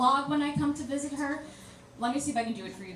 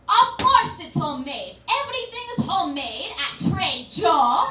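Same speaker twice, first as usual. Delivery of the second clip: indignant grandma; 4 semitones higher, 6 dB louder than usual. Retold or imitated.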